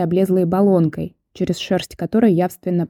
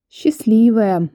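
"Счастливая" slow and drawn out, higher, envious; envious sigh; 4 semitones higher, much slower than usual. A narrator's reading. Visitor envies neighbour of famous Ёлкин, envious sigh. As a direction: sigh it out with longing envy. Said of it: "завистливо вздохнула я".

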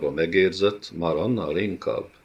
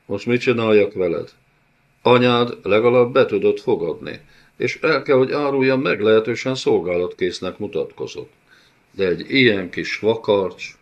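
higher, louder lewd mockery; taunting villain; 3 semitones higher, 5 dB louder than usual. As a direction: relish humiliating her. Villain taunts about her mother. (gúnyolódó, kéjes gonosz úr)

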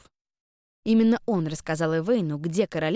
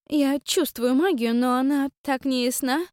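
higher, slow, puzzled queen; queen's voice; 7 semitones higher, 4 dB louder, slower than usual. Acted. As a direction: sense the magic slowly, higher. Queen senses magic.

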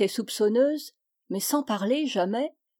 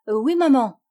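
louder, eager exclamation; joyful cry; 3 semitones higher, 6 dB louder than usual. A narrator's reading. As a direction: cry out a delighted yes.

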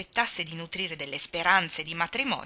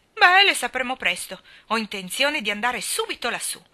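eager; 6 semitones higher, 8 dB louder than usual. A narrator's reading. Mrs Linde eager to go.

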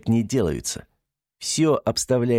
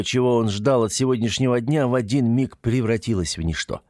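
usual speaking voice

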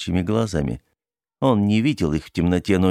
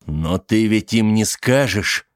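slow desperate plea; 3 semitones higher, slower than usual. Acted. Charlie pleads, gripping her wrists.

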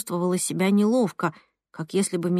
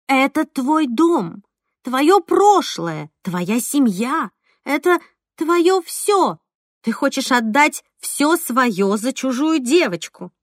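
higher, slow insistent repeating; repeating like wound up; 5 semitones higher, 3 dB louder, slower than usual. Acted. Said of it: "как заведённая повторяла Алёна".

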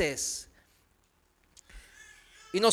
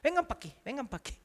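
put-on higher, coaxing call to the boys; elderly sister coaxing the teenagers over; coaxing old sister; 6 semitones higher, 9 dB quieter, much faster than usual. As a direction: call them over like a grandmother. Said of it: "la hermana dice".

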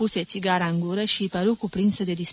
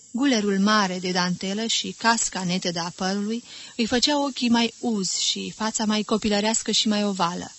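ordinary delivery, nothing marked

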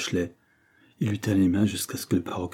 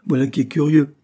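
amused, higher and louder reply; laughing; 7 semitones higher, 8 dB louder than usual. A narrator's reading. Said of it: "dit William en riant". (en riant un peu)

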